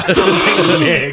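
put-on slow, laughing Transylvanian accent. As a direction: say it like a vampire. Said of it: "act like a Transylvanian".

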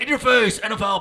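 shouted hype-man NFL call; mock sports announcer. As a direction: yell it like a loud TV announcer. Mocking loud TV announcers.

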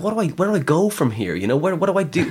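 high, worked-up questioning; retold bewilderment. Retelling his bewildered younger self.

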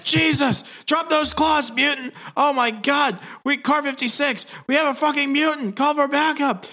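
very high, frantic cop voice; mock panicked cops. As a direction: imitate officers freaking out on the radio. Cops panic at seeing a mutant.

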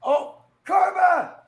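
drawn-out, loud karma; slow mock shout. Shouting the word of the day.

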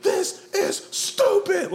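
mock battle roar, each word drawn out; high, very loud, slow hammed-up war cry; Gerard Butler. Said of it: "can you imagine your Jarvis being like".

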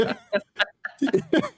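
high, breathy giggle; a squeaky burst of laughter; real high-pitched giggle. Real, not acted. Laughing at a dark joke.

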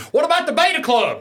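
high heckling yell from the back. Pretend. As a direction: heckle loudly across the room. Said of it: "I wanted to yell from the back of the room".

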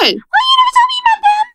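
very high, fast excited shout. Real, not acted. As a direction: shriek it in excited outrage. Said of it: "got me all excited".

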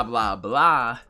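ordinary talk, nothing marked

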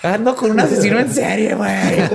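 put-on high ditzy girl voice. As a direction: play a silly girl realizing her mistake. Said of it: "llegó un punto donde dijo". (voz de chava fresa, aguda)

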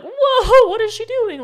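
high, loud laughing whoa of disbelief; shocked reader. Retold or imitated. Reader reacting to the girl jumping, shocked and laughing. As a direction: gasp it out, half laughing. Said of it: "that was like".

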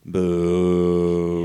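long level drawn-out boo; mock booing. Host heckles the Yankees over a huge contract, booing. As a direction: jeer like a rival fan.

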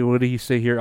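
ordinary delivery

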